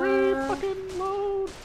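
slow, very high stretched complaint; exasperated drawl. Real, not acted. Gamer groaning at the reload time, slow and very high. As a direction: drag it out in disbelief.

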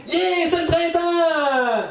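high, slow, stretched cheer; excited yell. Retold or imitated. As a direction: belt out the joy of spring. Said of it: "ils crient".

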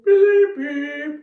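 loud alien bleep, then a sung one; alien language. Shown as a demonstration. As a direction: beep like a little robot alien. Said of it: "to say goodbye, we go".

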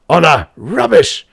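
loud, high, irritable dismissal; testy. A narrator's reading. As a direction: throw it back testily. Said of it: "said the professor testily".

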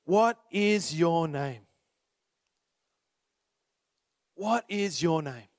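slow, low searching question. Acted.

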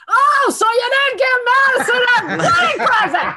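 high, loud teasing voice; loudly innocent tease in the hallway; mock-innocent public tease; imitating Jay. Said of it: "Jay in the hallway".

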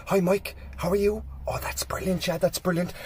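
hushed, voiceless whisper. Acted. Pharmacist greeting a customer with the harmless part, whispered. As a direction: whisper it discreetly across the counter. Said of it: "they'll whisper the right thing".

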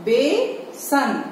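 very slow, stretched word; slow syllable-by-syllable reading of बेसन; drawn-out reading. Shown as a demonstration. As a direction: draw the word out for young children. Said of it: "तो हम कैसे पढ़ेंगे इसको".

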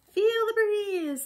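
excited call. Acted. Excited kids on sky ride.

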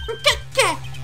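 high-pitched startled cry; shocked. Acted. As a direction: squeak out your disbelief. Personajes se sorprenden. (sorpresa con voz muy aguda)

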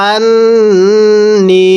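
আন্নি with the ন্ন held nasal and long; ghunnah. Shown as a demonstration. Teacher demonstrates ghunnah, slow nasal hold. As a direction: hum through the nose on আন্নি. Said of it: "গুন্নাহ করে পড়তে হবে".

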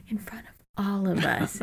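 hushed whisper, no voice. Retold or imitated. Podcaster whispering about her teacher saying crap. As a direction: whisper it like a naughty secret.

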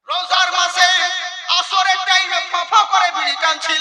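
high, loud indignant cry; outraged shout. Acted. Preacher denouncing Ramadan smokers, shouting high. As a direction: blast the crowd with the scandal.

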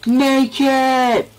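high loud victory shout; triumphant yell. Real, not acted. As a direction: yell as you make the jump. Triumphant leap in game.

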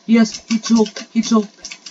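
high, loud burst of relief; excited cheer. Real, not acted. Player sees that he choked, excited cheer. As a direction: celebrate the rival's slip, loudly. Rival fails, player rejoices.

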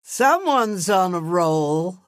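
ordinary talk

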